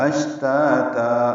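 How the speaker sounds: اشتاتا said very slowly with the long final alif when stopping; pausal form. Shown as a demonstration. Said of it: "اگر رکنا ہے تو". (وقف پر اشتاتا)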